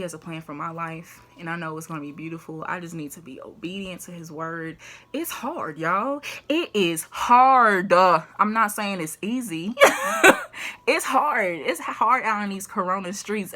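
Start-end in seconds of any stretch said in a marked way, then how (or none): 5.1-13.5 higher and louder, half laughing; loud laughing emphasis. Real, not acted. To viewers about hard times, loud and laughing. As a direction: cry out that it's hard, grinning.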